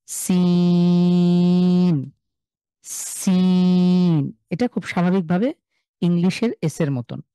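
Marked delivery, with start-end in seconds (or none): 0.1-4.3 সিন with the vowel held very long, a bit louder; long seen. Shown as a demonstration. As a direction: stretch সিন for three counts. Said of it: "তিন second long করব".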